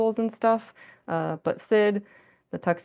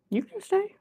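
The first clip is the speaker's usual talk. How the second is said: pet talk; 13 semitones higher than usual. Real, not acted. Streamer letting her settled cat stay on her lap, high pet talk. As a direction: reassure the kitty, pitched way up.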